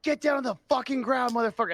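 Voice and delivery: cop barking an order, pitched high; high-pitched mock cop yell at a fleeing man. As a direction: yell it like a cop making an arrest. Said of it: "yelled at him".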